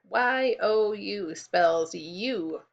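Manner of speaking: spaced letters; slow spelling. Shown as a demonstration. Teacher spells the sight word letter by letter. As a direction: spell each letter distinctly. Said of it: "The second sight word".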